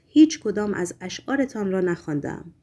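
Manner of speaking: ordinary delivery, nothing marked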